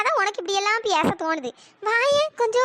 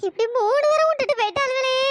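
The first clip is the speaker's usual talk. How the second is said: sobbing lament. Acted. Mourner grieving over three sudden deaths, sobbing lament. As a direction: mourn aloud, stretching words into cries. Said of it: "கிடந்து அழுதுட்டு கிடக்கவே".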